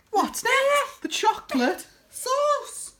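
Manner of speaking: high and loud, then a slow, drawn-out sauce; what's next high and loud, chocolate sauce stretched out slowly; excited reveal. Real, not acted. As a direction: announce it like a treat.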